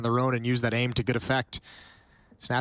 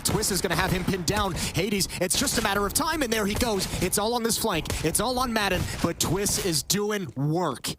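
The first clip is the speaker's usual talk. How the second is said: excited; 6 semitones higher than usual. Real, not acted. Caster builds as kills land.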